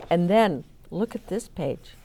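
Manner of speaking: ordinary talk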